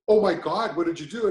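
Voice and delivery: astonished. Retold or imitated. Doctor to patient over the paperwork, astonished. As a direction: act out a doctor taken aback.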